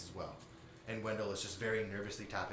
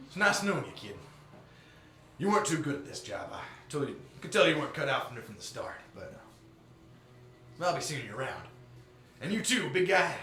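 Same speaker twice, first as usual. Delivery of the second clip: loud, taunting mob enforcer; menacing gang boss; 7 semitones higher, 10 dB louder than usual. Acted. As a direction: threaten the kids with a grin. GM voices gang NPC threat.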